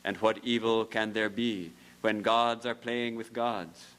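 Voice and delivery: ordinary delivery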